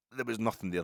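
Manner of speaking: ordinary talk, nothing marked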